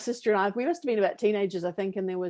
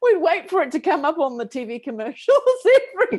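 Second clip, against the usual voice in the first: high, loud, through helpless laughter; real giggling as the words come out; laughing; 11 semitones higher, 7 dB louder than usual. Real, not acted. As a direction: talk while cracking up. Laughing at her own retold memory.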